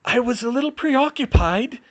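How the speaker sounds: strained, high voice. A narrator's reading. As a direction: force the words out in pain. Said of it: "she managed".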